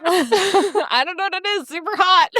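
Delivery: breathless giggly gushing; laughing gush. Real, not acted. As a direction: swoon over him through laughs. Gushing over the hero.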